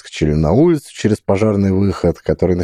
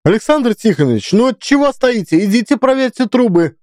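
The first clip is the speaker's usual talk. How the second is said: high shrill barked order. A narrator's reading. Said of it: "визгливо рявкнула Фёдоровна".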